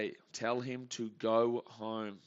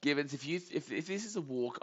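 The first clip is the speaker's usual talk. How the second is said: ordinary talk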